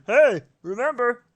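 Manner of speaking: high cartoon nudge; page voice. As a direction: chirp it up high. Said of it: "it's like".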